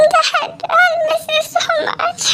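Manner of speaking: high, very slow, tearful through sobs and a little laugh; crying. Acted. Bride at her farewell tells her dad she misses him, crying. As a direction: let the words break as she cries.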